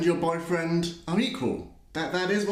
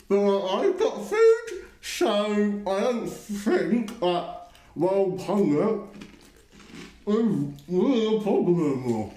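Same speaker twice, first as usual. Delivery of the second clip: mocking parody voice; 3 semitones higher, slower than usual. A mocking voice parodying an argument that ignores hunger. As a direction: lean on "food" and take the rest slowly in a put-on voice.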